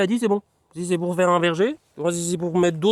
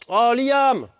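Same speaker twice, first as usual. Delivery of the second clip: calling the dog; 4 semitones higher than usual. Real, not acted.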